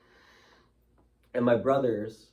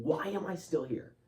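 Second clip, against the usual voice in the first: screaming; 6 semitones higher than usual. Retold or imitated. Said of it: "and I'm screaming".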